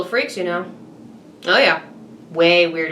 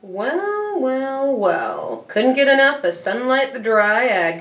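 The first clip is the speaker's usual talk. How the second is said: smug gloat; 6 semitones higher, slower than usual. Acted. Sunlight the dryad gloating at the queen. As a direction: savor each well.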